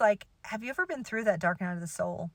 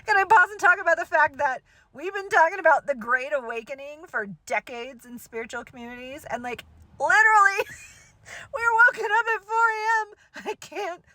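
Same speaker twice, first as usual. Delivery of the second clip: laughing; 9 semitones higher, 7 dB louder than usual. Real, not acted. Laughing at herself mid-story.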